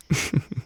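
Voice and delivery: short, quiet amused laugh; soft chuckle. Acted. Witch amused by Ruggiero's grand words, soft chuckle. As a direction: chuckle softly to yourself.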